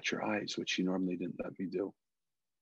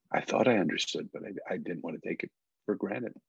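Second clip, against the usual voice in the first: ordinary talk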